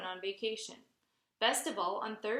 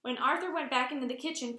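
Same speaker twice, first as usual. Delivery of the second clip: usual speaking voice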